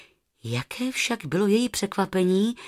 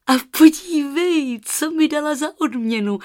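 put-on higher, louder excited girl; excited calling; 7 semitones higher, 6 dB louder than usual. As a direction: show off the feather eagerly. (nadšeně volá na tetu)

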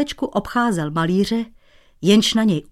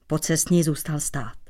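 ordinary delivery

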